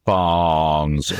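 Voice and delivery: "Barnes" held long and slow; drawn out. Shown as a demonstration. Author shows the sound people make, drawn out. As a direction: stretch the vowel in "Barnes". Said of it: "slow down and they'll make that".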